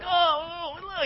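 high-pitched recoiling "oh, oh"; disgusted groan. Retold or imitated. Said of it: "it's just disgusting".

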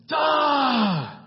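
high, loud mocking duh; mock duh shout. Pastor to congregation, loud mocking duh. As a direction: shout it like it's obvious.

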